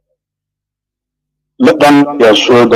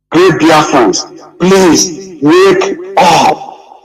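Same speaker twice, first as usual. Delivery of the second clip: slow, choked plea, higher and breaking; voice breaking into tears; 3 semitones higher, much slower than usual. Real, not acted. Speaker begging fellow Biafrans to wake up, voice breaking. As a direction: plead with them as the tears come. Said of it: "I'm getting emotional".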